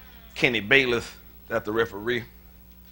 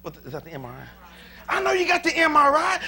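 ordinary talk

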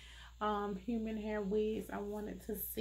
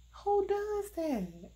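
much higher, slow, louder outburst; incredulous outcry; 10 semitones higher, 3 dB louder, much slower than usual. Real, not acted. Reviewer crying out at the badly made wig cap. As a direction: cry it out in disbelief.

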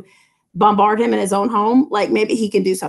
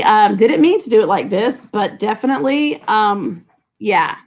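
normal talking voice

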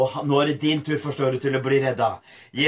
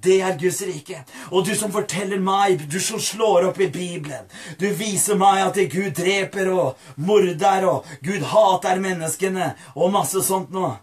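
high, raised preacher's voice; impassioned pulpit delivery; full-force preaching; 5 semitones higher than usual. Real, not acted. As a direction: preach it out with urgency.

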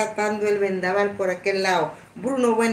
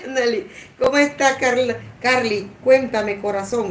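llamada emocionada; 4 semitones higher than usual. Retold or imitated. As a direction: call out to her, thrilled and laughing. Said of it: "Y yo digo". (llamada aguda y emocionada entre risas)

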